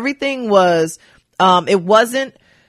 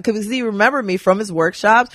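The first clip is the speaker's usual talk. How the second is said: ordinary talk, nothing marked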